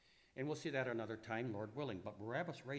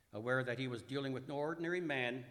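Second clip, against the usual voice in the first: ordinary talk, nothing marked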